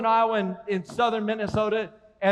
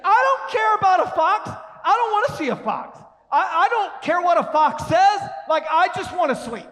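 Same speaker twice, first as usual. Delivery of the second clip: much higher, loud whining refusal; whiny kid protest; 7 semitones higher, 3 dB louder than usual. Retold or imitated. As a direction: complain like a grumpy sleepy boy. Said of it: "I'd be like".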